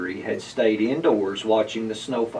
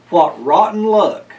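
frustrated; 5 semitones higher, 7 dB louder than usual. A narrator's reading. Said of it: "he said".

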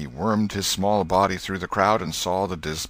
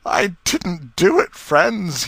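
higher, louder, slow pleading sob; sobbing plea; 12 semitones higher, 7 dB louder, much slower than usual. Acted. Potter, in tears before the dead man, begs his friends, sobbing. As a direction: beg the crowd through tears. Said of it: "he sobbed".

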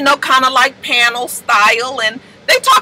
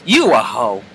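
mock mirror affirmation; 5 semitones lower, slower than usual. Treasure affirming herself in the mirror, low mock pep talk. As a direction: say it to yourself in the mirror. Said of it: "she look in the mirror and she say".

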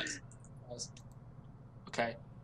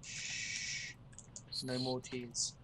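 quiet shushing, soothing; soft hushing; 2 semitones lower, 10 dB quieter, much slower than usual. Acted. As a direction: hush them gently. Hushing a friend softly.

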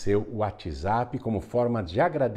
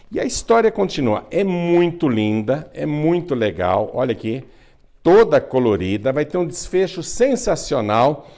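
excited pitch; 6 semitones higher, 7 dB louder than usual. Real, not acted. Excited promo of the book.

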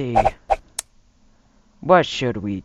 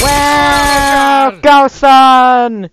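character shout; 10 semitones higher, 8 dB louder, much slower than usual. Pretend. Voicing a character in a game.

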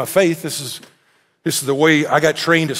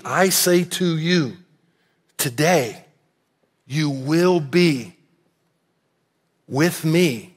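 solemn; 3 dB quieter, much slower than usual. Acted. Reading Jesus's words solemnly.

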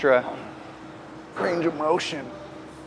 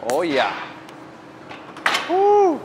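pumped up; 3 semitones higher than usual. Real, not acted. Starting the burnout set.

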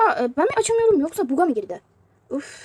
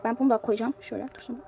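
ordinary talk

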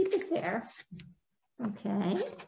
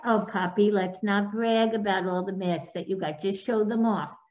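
ordinary talk, nothing marked

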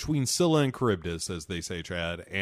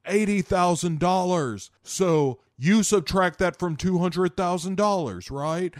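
hammed-up haggling hustler; 6 semitones higher than usual. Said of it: "Nicolas Cage ... is like".

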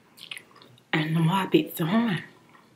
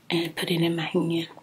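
ordinary talk, nothing marked

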